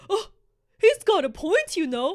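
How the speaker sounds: hammed-up mimic of movie Ron; mocking movie Ron. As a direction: sneer it like a spineless sidekick. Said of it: "What the fuck was that?".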